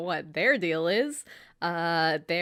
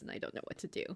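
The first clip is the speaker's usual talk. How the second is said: despairing whisper; 13 dB quieter, much faster than usual. Retold or imitated. Riku crying, lost.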